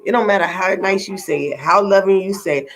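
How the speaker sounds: ordinary talk, nothing marked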